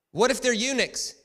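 high, loud mock heckler; hammed-up yell from the crowd; shouted crowd question. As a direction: shout it like an incredulous audience member.

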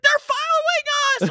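high, loud imitation of a terrified kid; hammed-up retold panic; panicked child. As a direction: shriek it like a frightened daughter. Imitating terrified daughter fleeing.

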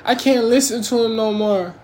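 a hint of a nervous churchgoer complaining; scared listener. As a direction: lightly play someone put off by the preacher. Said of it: "then be like".

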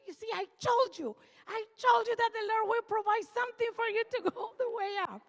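put-on high, fast child voice, triumphant; high kid voice rushing with joy; excited grandson. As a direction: crow it like a proud little boy.

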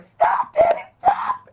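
put-on very high, loud child's cry; mock kid yelling. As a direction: play the panicked kid shouting. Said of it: "a kid in the corner is like".

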